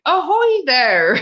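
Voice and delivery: stretched-out hammed-up pirate greeting; pirate hail. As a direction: hail them like a pirate at the airport. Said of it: "and I'm going".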